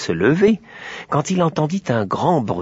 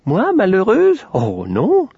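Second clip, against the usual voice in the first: Aurore étonnée; 9 semitones higher, slower than usual. Acted. Aurore s'étonne.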